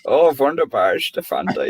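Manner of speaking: mock German accent; hammy German accent voice. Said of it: "Oh, that was brilliant".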